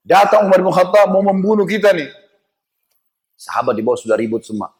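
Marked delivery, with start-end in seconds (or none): 0.1-2.1 higher-pitched warning, only mildly shouted; acted alarm, voice pitched up; retold alarm cry. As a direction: imitate a lookout warning the others. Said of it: "Teriak dia".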